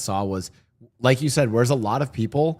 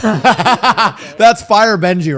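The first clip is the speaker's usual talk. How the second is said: loud, higher, slowed by laughing; real laughter; 8 semitones higher, 9 dB louder, much slower than usual. Real, not acted. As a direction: laugh hard through the words. Cracks up at own Benji joke.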